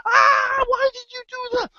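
very high, loud squeal of pain; hammed-up high-pitched wail; pained cry. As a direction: yelp as if just stung, protesting. Frog stung a second time.

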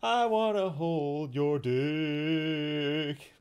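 slow, drawn-out delivery. Shown as a demonstration. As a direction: stretch every word out slowly. That's a pretty good one.

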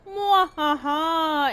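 slow mad scientist cackle; evil laugh. Pretend. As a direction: cackle like a cartoon villain. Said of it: "I wanna feel evil".

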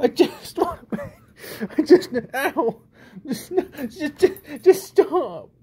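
high laughing pleas to stop; laughing protest. Real, not acted. Owner laughing while the pet keeps biting, high laughing pleas. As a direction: laugh through the pain while begging.

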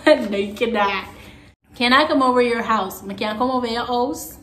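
ordinary delivery

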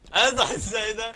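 high, slow shout of "As I say that"; mock dramatic shout. Teammate yelling at his own jinx in the game, slow mock shout.

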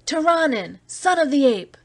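high jeering yell from the mob; howled abuse. A narrator's reading. Mob howls abuse at the Terran. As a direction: hurl the insult up at him. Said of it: "a howl of abuse went up".

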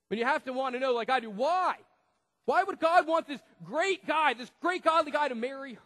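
loud, high, pleading question; fervent preacher's why; impassioned preaching. Acted. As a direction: demand the answer from the crowd.

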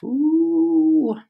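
high, pleased little ooh at her own sentence; self-impressed squeal. Real, not acted. Teacher pleased with her sentence, high delighted ooh. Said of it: "Ooh, I just impressed myself".

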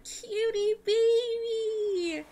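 high, drawn-out happy squeal; gushing, high-pitched delight; delighted cooing. Real, not acted. Delighted at finding cute elephant.

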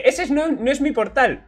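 Pathfinder quote. Retold or imitated. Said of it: "Le dice". (imitación ligera de Pathfinder, más aguda)

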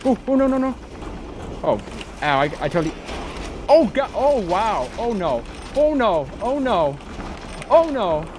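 high frantic oh no cries; panicked yelps; terrified gibbering. Real, not acted. Hit by monster.

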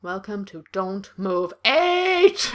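very slow, drawn-out announcer welcome; slow mock host voice; odd announcer voice. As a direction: stretch it like a show host. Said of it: "I don't know why I'm talking like that".

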